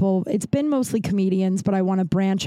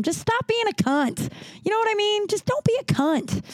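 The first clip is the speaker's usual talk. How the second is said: mock high scold; 13 semitones higher than usual. Host scolding rude smokers in a mocking high voice. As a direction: squeak it out like a prissy scold.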